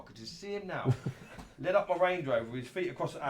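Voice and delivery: ordinary delivery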